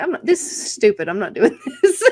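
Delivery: mock refusal breaking into laughter; imagined Lucius refusal. Comic imagining a fellow comedian's reaction, mock refusal cracking up. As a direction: play a protest, laughing through it. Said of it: "I feel like Lucius would be like".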